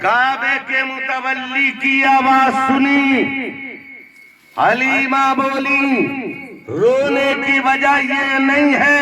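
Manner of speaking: sustained, ringing preacher's delivery; declaimed sermon storytelling. Acted. Preacher tells Halima's weeping to the congregation, declaimed sermon storytelling. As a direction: draw out each phrase for the crowd.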